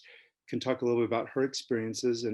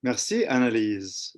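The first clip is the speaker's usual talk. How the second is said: ordinary talk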